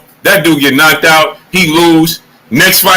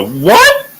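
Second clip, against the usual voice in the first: high rising what; baffled what; incredulous what; 8 semitones higher than usual. Real, not acted. Streamer reacting to fans, high disbelief.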